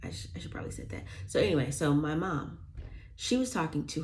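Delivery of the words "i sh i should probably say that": whispered aside. Real, not acted. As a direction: drop to a whisper, as if telling a secret. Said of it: "Sorry, I walk in the office".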